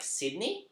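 "Sydney" drawn out with a rise; rising inflection. Shown as a demonstration. Showing the co-host a regional accent, "Sydney" rising at the end. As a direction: lift the end of "Sydney" slowly. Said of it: "they have that inflection at the end".

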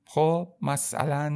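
ordinary talk, nothing marked

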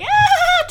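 bright gasp. Shown as a demonstration. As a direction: flip into a high gasp. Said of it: "when people go from, like".

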